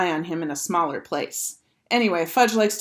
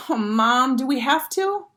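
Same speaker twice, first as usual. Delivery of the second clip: put-on higher-pitched reluctant kid plea; reluctant complaint; 6 semitones higher than usual. A narrator's reading. Boy asks mom to be let off, reluctant complaint. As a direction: protest to a parent in a raised pitch. Boy objects to watching brother.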